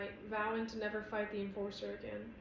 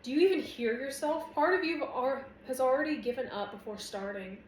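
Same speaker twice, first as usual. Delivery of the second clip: raised, higher voice in argument; heated rebuke that eases toward the end; heated challenge; 4 semitones higher, 7 dB louder than usual. A narrator's reading. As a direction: confront them, loud and exasperated.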